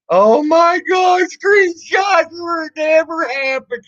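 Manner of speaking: high, slow mock yell of the troll's line; mocking shout. Streamer mocking a troll's old catchphrase, shouted high. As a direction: shriek his dumb saying back at him, slowly.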